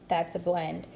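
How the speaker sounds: ordinary talk, nothing marked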